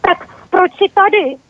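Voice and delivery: high nervous question; trembling question. A narrator's reading. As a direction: ask though your legs shake. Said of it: "přitom se mu klepaly nožičky".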